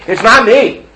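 It's not me, with "not" hit hard, loud and high; almost shouting the denial; loud, high protest. Acted. Repeated denial in acted plea.

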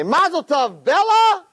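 high, loud cheer of mazel tov; festive announcement. Acted. Father and son announcing the wedding to Bella, loud high cheer. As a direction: call it out to the maid, loud and high.

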